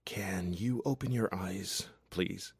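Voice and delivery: very soft, slow whisper; gentle request. A narrator's reading. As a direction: ask gently, almost without voice. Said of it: "he gently requests".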